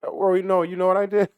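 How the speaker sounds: high, loud mocking woman; mocking lady voice. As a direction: mimic her high and bossy. High voice mimicking customer.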